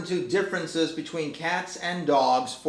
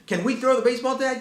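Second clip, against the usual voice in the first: put-on excited little boy; 9 semitones higher, faster than usual. Son voiced in a story.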